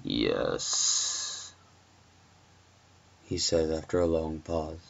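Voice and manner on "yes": very low, drawn-out creepy yes; menacing caller. Acted. Stranger confirming he can see the babysitter, low and menacing. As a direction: let it slide out slowly, deep and sinister.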